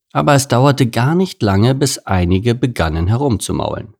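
ordinary delivery

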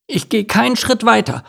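high, stubborn duckling; defiant little one, pitched high; announcing. A narrator's reading. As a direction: declare it firmly in a high child's voice. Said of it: "verkündete er".

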